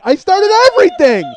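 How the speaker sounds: very high, very loud scream; screamed realisation. Retold or imitated. Guard realising he set it all off, screamed realisation. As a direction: blow up into a full-force shriek of dismay.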